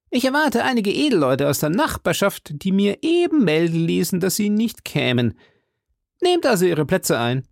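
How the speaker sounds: high, put-on character voice; hearty musketeer host. Host invites arriving friends to eat, high and hearty. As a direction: welcome them in a high, hearty voice. Porthos voiced in story dialogue.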